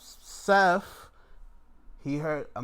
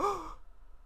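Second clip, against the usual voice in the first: mock gasp; 7 dB quieter than usual. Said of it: "He just was like".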